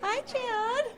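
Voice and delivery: high, singsong flirtatious hello; flirty greeting. Acted. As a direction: coo it to catch his eye. Mother flirts with younger man.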